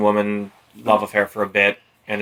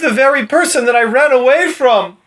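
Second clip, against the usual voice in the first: much higher, aghast realization; shocked heroine; 13 semitones higher, 4 dB louder than usual. Retold or imitated. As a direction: gasp it out in horror. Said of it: "she's like".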